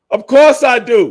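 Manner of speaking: high, loud, cocky of course; loud bragging retort; excited boast. Real, not acted. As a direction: crow it proudly.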